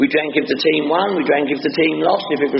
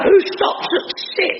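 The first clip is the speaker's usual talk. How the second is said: laughing; slower than usual. Real, not acted. Laughing rhetorical question.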